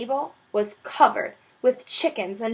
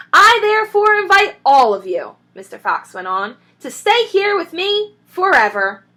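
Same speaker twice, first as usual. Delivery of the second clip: high, loud announcement; grand invitation; 8 semitones higher, 7 dB louder than usual. Acted. Mister Fox inviting everyone to stay, grandly.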